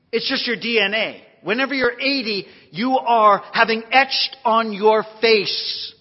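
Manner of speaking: loud, high pulpit emphasis, slowing at the end; preaching. Real, not acted. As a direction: press the point on the congregation. Preaches at full force.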